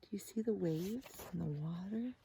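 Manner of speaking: ordinary delivery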